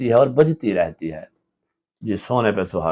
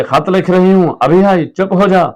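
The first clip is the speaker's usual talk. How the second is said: high, loud call; 8 semitones higher, 7 dB louder than usual. Acted. Wife shouting to her child while writing a letter, loud. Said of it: "बीवी जोर से".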